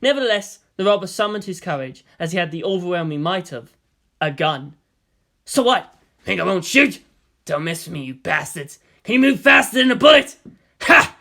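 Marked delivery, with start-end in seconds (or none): 5.5-11.2 loud, high mocking threat; robber's bravado. A narrator's reading. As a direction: puff up with false courage. Robber rallies with a gun.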